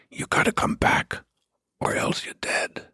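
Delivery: unvoiced whisper, close and menacing; whispered threat. A stranger whispering a threat at the train platform, hushed. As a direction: breathe the threat right into his ear. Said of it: "he whispers into my ears".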